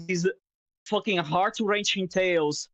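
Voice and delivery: usual speaking voice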